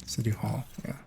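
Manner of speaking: whispered under the breath; whispering. Real, not acted. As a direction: whisper it to yourself. Muttering the destination to himself.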